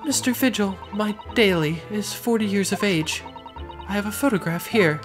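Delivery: high-pitched refined lady. Acted. Client describes husband.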